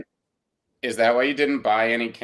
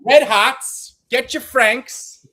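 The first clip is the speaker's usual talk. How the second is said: much higher, louder, slow hawker's call; old-time boardwalk vendor; 11 semitones higher, 5 dB louder, much slower than usual. Pretend. Hosts riff on old boardwalk vendors, hammed-up hawker call. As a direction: bark it like a nineteen-twenties hot dog seller. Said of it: "Atlantic City Boardwalk circa nineteen twenty-two".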